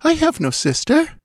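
very high, confused protest; bewildered. A narrator's reading. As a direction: protest in a high, baffled girl's voice. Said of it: "stammered the bewildered girl".

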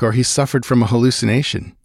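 usual speaking voice

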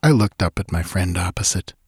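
ordinary delivery, nothing marked

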